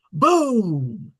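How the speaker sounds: usual speaking voice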